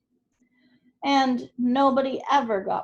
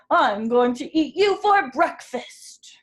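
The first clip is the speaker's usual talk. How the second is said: menacing troll; 3 semitones higher, 8 dB louder than usual. A narrator's reading. Troll threatens goat.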